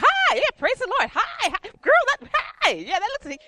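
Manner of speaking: hammed-up gushing greeter, very high and loud; Linda. Imitating a friend greeting everyone at church, high and effusive. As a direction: squeal hellos at everyone you meet. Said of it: "She's like".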